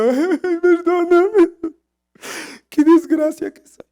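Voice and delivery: high-pitched, drawn-out mock pleading; súplica de perdón. Reenacts begging God's pardon on floor. (súplica aguda y exagerada de perdón)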